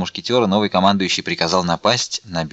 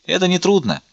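high-pitched, eager exclamation; exclaiming; 9 semitones higher than usual. A narrator's reading. Porthos eagerly answers the officer, exclaiming. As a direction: burst out with easy confidence. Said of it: "воскликнул Портос".

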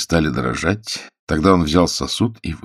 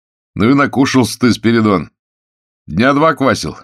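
put-on loud, higher-pitched ribbing of a drunk; chiding jailer; 6 semitones higher, 5 dB louder than usual. Warder chides a hungover prisoner, loudly chiding. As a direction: scold him heartily, pitch up.

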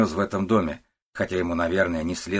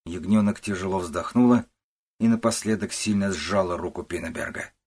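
usual speaking voice

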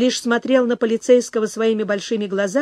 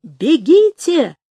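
loud, high, drawn-out warning cry; shouted alarm; 5 semitones higher, 6 dB louder, much slower than usual. A narrator's reading. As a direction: yell a long warning to the troops. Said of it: "крикнул Дрёма". (громкий протяжный крик тревоги)

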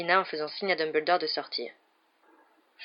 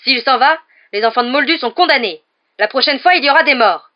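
much higher and louder, full-throated yell; 7 semitones higher, 13 dB louder than usual. A narrator's reading. Hagrid yells at Malfoy not to dismiss Dumbledore, huge yell. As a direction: yell it so the dog hides.

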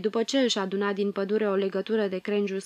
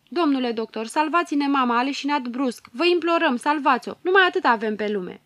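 louder, higher desperate begging; tearful pleading; 4 semitones higher, 5 dB louder than usual. Acted. Daughters begging the doctor to save their mother, tearful and loud. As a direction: beg the doctor to save her. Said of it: "îl imploră plângând".

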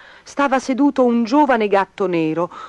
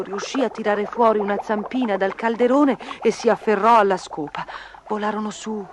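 usual speaking voice

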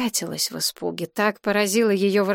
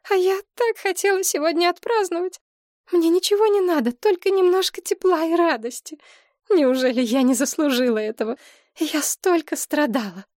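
put-on high, whining lament of an old mother; self-pitying mother; 9 semitones higher than usual. A narrator's reading. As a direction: plead high and pitiful. (высокий жалобный голос матери, себя жалеет)

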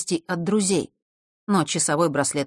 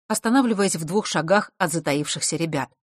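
plain reading voice

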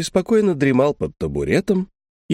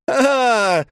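shouting over noise; 11 semitones higher than usual. A narrator's reading. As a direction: shout to be heard over the roar. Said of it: "крикнул он, стараясь перекричать рёв".

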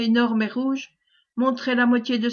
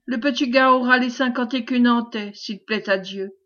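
ordinary delivery

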